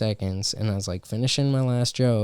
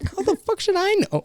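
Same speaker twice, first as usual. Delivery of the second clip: much higher, fast exasperated squeak; faster than usual. Retold or imitated. Comic answering a puzzled friend, exasperated. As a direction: throw up your hands at the question. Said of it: "I was like".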